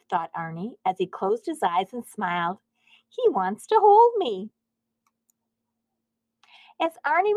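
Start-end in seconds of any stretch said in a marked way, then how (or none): 3.1-4.5 delighted. A narrator's reading. Arnie delighted, voiced by narrator.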